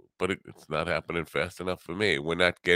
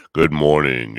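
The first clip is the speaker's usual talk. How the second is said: louder, punchy good morning; high energy greeting; 11 dB louder than usual. Shown as a demonstration. Radio host greeting listeners with a loud energetic morning. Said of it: "Yeah, bring up the energy".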